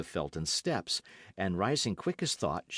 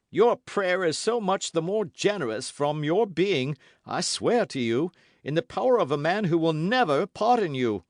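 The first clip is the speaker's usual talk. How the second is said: louder, higher, menacing; angry vow; 9 semitones higher, 7 dB louder than usual. Acted. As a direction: swear it at her in rage. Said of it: "cried the Baron".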